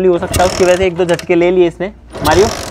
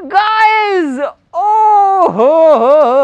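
high, drawn-out cries of wonder; amazed exclamation; 8 semitones higher, much slower than usual. Real, not acted. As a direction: call out to the viewers in thrilled disbelief. Amazed that the bike started.

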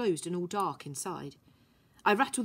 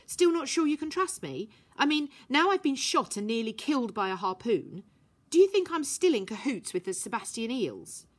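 put-on higher girl's voice, pointed and defiant; girl's voice, challenging; 5 semitones higher, 4 dB louder than usual. Violet to Herbie about trust, a defiant girl's voice. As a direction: chin out, daring him to doubt her.

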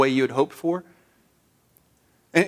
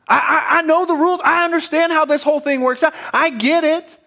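higher, insistent, exasperated Martha; exasperated insistence; 8 semitones higher than usual. Retold or imitated. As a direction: pile it on, I know, I know.

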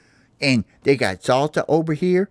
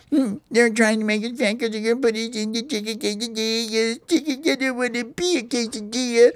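hammed-up wail; 9 semitones higher than usual. Parakeet fears being fattened for quesadillas, high panicked wail. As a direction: wail it like a doomed little bird.

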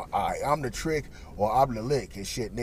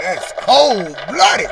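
slow, loud, high exclaim; loud drawn-out disbelief; 7 semitones higher, 12 dB louder, much slower than usual. Retold or imitated. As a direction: stretch it out in shocked disbelief. Friends reacting to cold move.

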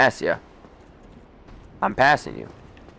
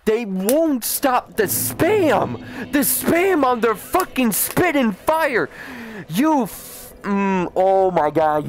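high-pitched rage rant; 6 semitones higher than usual. Real, not acted. Raging at spamming enemies.

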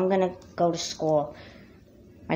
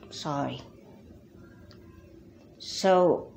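normal talking voice

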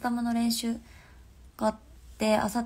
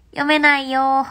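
louder, laughing complaint; loud protest; 3 semitones higher, 12 dB louder than usual. Real, not acted. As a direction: shout it out in mock protest. Protesting she can't read it.

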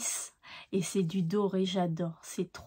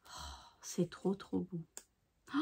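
hushed delight; 3 semitones lower, 10 dB quieter than usual. Real, not acted. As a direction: sigh it out in quiet wonder. Delighted by the last card. (émerveillement chuchoté)

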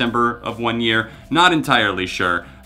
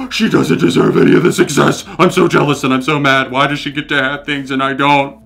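put-on louder, whiny jealous teenager ranting; jealous teen; 6 dB louder than usual. Mocking his teenage self raging about [name], loud whiny jealousy. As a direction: send up your bitter teenage self. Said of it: "sitting there being like".

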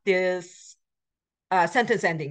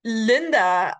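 ordinary talk, nothing marked

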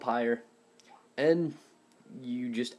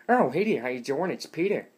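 loud, higher-pitched cartoon dad impression; Peter Griffin attempt; 4 semitones higher, 5 dB louder than usual. Shown as a demonstration. Showing viewers it took practice, loud Peter Griffin attempt. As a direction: belt out a rough try at the cartoon greeting. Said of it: "No, it didn't work that way".

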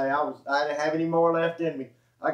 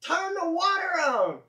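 much higher, slow yelled retort; exasperated high holler; retold shout; 13 semitones higher, slower than usual. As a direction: shout it back over the noise. Yelling back at the fireman.

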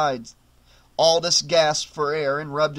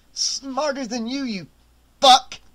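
choked defiance; 8 semitones higher, 3 dB louder than usual. A narrator's reading. Aldous choked.

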